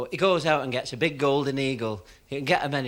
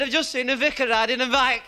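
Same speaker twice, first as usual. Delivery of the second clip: laughing punchline; 7 semitones higher, 5 dB louder than usual. Acted.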